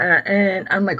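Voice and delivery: ordinary delivery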